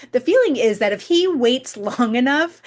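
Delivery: giggling. Real, not acted. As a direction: laugh through the words. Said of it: "I think I'm giggling".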